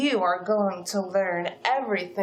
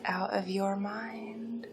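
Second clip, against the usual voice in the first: whisper; 2 semitones lower, 8 dB quieter than usual. Acted. Menacing whisper to captive.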